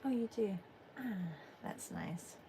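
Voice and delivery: ordinary delivery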